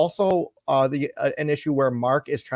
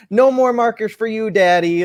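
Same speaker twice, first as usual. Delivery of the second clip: put-on higher, louder, playful little-girl voice; teasing little girl; 9 semitones higher, 6 dB louder than usual. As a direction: tease daddy with a smile. Looks at him and smiles.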